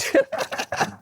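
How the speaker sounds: high-pitched laugh; short burst of amused laughter. Real, not acted. Laughs at own digression.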